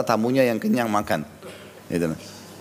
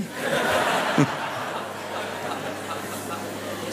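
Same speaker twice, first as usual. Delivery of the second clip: breathless unvoiced laughter; airy laugh; 3 semitones higher, 8 dB quieter than usual. Real, not acted. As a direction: laugh at his own joke. Tertawa setelah lelucon. (tawa tanpa suara)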